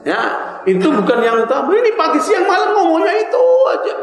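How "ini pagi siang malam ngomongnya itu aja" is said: high, stretched-out mock complaint; exasperated mockery. Preacher chiding people who talk of one thing, mock exasperation. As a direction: pitch it way up and hold the last words.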